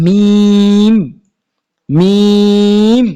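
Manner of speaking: মিম held long and firm; drawn-out Arabic mim. Shown as a demonstration. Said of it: "এই হরফটার নাম কি".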